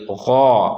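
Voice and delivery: गौ with a thick throaty g and rounded aw; heavy gho. Shown as a demonstration. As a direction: fill out गौ, long and back. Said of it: "बारीक नहीं पढ़ेंगे".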